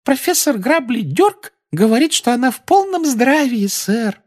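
very high adoring squeak; squeaky elf voice. Acted. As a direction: squeak it with adoration. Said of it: "пропищал эльф".

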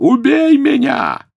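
slow, very high, loud shriek; long piercing wail; agonised scream. A narrator's reading. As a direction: scream it out in torment. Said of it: "завопил".

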